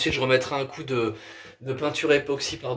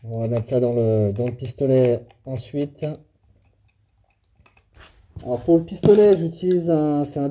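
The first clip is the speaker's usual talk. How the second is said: muffled, speaking behind a respirator; through a face mask; 3 dB louder than usual. Real, not acted. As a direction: talk through a paint mask. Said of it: "j'ai le masque".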